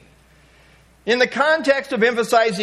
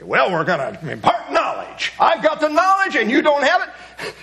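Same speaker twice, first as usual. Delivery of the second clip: smug know-it-all; 8 semitones higher than usual. Pretend. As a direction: sneer like a superior know-it-all. Mocking a know-it-all teacher.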